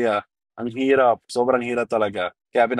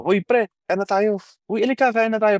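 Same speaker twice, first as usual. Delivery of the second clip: friendly call to eat; 9 semitones higher than usual. Retold or imitated. A Filipino inviting a passerby to join the meal, in a high, friendly calling voice. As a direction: pitch raised high, calling a passerby over. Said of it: "sasabihin sa'yo".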